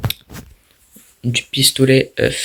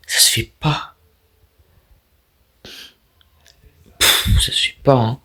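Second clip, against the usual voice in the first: half-whispered complaint; 4 dB quieter than usual. Real, not acted. Annoyed muttering at the game.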